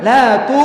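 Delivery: "la tu" loud, ending in a thick rounded dho; bibir maju. Shown as a demonstration. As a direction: push the lips forward on the end of "la tu". ("la tu" keras, bibir dimajukan)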